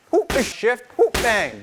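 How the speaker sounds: high punchy rhythm calls; whoop and bang calls. Shown as a demonstration. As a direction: call the combo out loud. Coach calls shift and punch.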